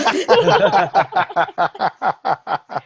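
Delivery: real, loud burst of laughter; long helpless laugh at your own joke; big cackling laugh that runs on; hearty laugh. Real, not acted.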